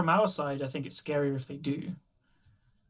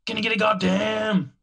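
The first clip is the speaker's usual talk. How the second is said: wrestling promo; 6 semitones higher, 7 dB louder than usual. Retold or imitated. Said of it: "we were doing a wrestling impression".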